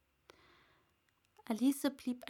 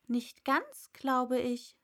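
ordinary delivery, nothing marked

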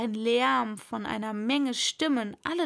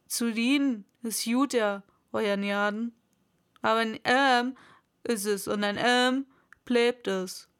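broad rustic character voice; simple servant saying "Arm" as "Ärm"; dialect servant; 3 dB louder than usual. A narrator's reading. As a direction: insist stolidly in dialect.

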